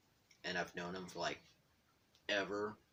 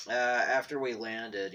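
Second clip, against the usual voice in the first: usual speaking voice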